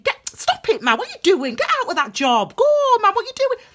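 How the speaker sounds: loud, high-pitched urgent scolding; exasperated universe. Acted. Universe ordering someone out of their job, exasperated shouting. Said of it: "the universe is saying".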